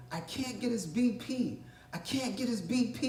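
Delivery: high, softened alarm, spaced out; nervous medic. Retold or imitated. EMS worker losing a patient in the ambulance, nervous and high. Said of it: "inflection in his voice that he's nervous".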